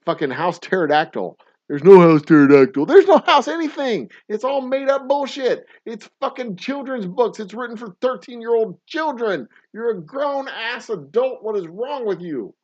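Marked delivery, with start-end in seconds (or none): 1.7-12.5 high, loud mock outrage rising into a squeal; hammed-up high-pitched tirade; exaggerated rant. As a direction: rant like an indignant crank, pitched way up. Mock rant about Harry Potter fans.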